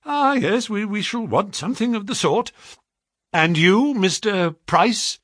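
high, faltering voice; rattled employer. Acted. Shaken Pinner interviews the visitors.

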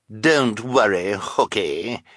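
mocking. A narrator's reading. Esme to the netted hook-handed man, mocking. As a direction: taunt him with false comfort. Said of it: "Esme replied mockingly".

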